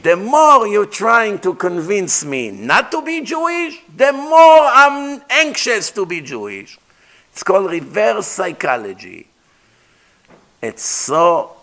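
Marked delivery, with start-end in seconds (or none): 0.0-6.8 insistent quote. Retold or imitated. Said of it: "Then she said".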